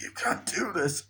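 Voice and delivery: high, hushed mock plea; desperate pleading. Homeowner pleading with the people evicting them, mock desperation.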